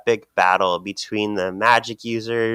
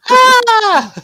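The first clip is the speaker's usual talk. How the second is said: high, loud comic gasp; mock startled shriek; 12 dB louder than usual. Co-host echoing a startled comic character, mock shriek. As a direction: shriek in exaggerated fright, half laughing.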